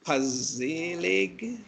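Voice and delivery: tentatively sounding out a surname, rising at the end; hesitant, questioning attempt at a name; unsure pronunciation. Real, not acted. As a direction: try the unfamiliar name as a question.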